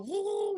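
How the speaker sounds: high rising woo like a machine; imitated monitor noise. Heart monitor going off at the news, high woo. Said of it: "you could hear the monitor go".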